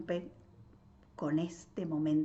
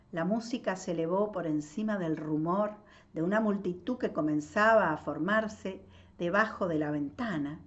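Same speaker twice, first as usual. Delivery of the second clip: ordinary delivery, nothing marked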